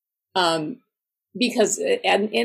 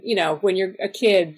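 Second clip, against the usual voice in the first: usual speaking voice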